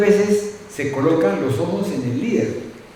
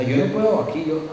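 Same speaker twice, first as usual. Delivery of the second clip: ordinary delivery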